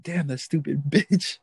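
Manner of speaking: half-laughing mock outrage; mock pissed-off. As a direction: imitate a pissed friend, laugh breaking in. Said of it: "And he was pissed".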